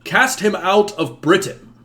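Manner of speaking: high, loud preacher's cry; impassioned shout. Acted. Priest demands the king banish the devil's son, shouting. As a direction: shout it as a command to the court. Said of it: "he shouts".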